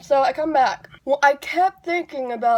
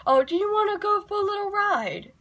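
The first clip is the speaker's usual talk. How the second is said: much higher, sing-song mock offer; playful high put-on voice; 10 semitones higher than usual. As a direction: tease like you're offering a kiddie ride. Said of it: "very seriously and go".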